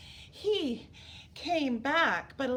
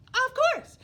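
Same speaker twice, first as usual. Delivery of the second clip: eager king; 12 semitones higher, 10 dB louder than usual. Acted. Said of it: "the king said".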